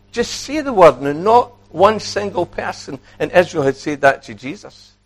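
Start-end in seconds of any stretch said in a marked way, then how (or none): 0.1-1.0 pleading centurion. Acted. Preacher repeats the plea, higher.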